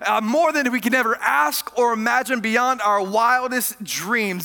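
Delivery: loud, high, ringing sermon climax; full-force preaching. Acted. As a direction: preach it out to the back rows.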